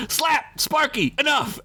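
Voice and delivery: high, sharp scolding calls; yelling back. Acted. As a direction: shout down the squabbling goblins. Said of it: "Axwood yelled back".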